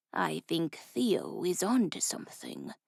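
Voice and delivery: low, soft rasping thug; a quiet rasp from the dark; raspy voice. A narrator's reading. Second thug chiming in with a soft low rasp. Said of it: "a new raspy voice added".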